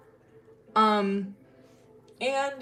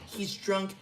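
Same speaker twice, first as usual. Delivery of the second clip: ordinary talk, nothing marked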